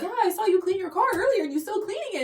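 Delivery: high, quick imitation of a friendly neighbor; chirpy neighbor. Neighbor remarking on her car cleaning, chirpy.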